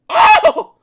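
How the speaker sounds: very high, loud squeak; startled by a tickle; alarmed squeak. Acted. Mister Grumpy squeaking as he is tickled, very high and loud. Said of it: "squeaked Mister Grumpy".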